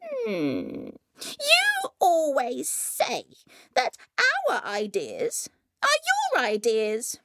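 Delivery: sceptical elder sister, starting high; a high hum, then a measured complaint. A narrator's reading. Elder sister reproaching Coyote, sceptical complaint. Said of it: "said the elder sister".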